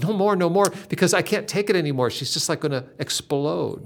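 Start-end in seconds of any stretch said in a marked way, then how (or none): 0.0-2.1 louder, higher, rushing plea; overwhelmed pleading. Retold or imitated. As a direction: beg breathlessly as if about to burst. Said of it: "she had to beg God".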